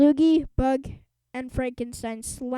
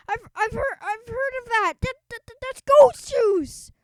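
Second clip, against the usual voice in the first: panicked whimper; 11 semitones higher, 8 dB louder than usual. Acted. Bully panicking over the foam.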